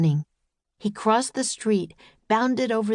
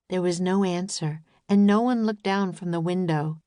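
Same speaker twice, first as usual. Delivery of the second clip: ordinary delivery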